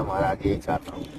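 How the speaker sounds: soft, low murmur of assent; humble reply. Retold or imitated. Seeker answering the guru who blessed him, humbly hushed. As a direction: answer the master humbly, dropping pitch and volume.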